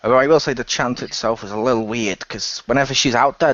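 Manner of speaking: usual speaking voice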